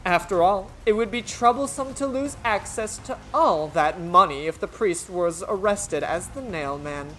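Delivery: usual speaking voice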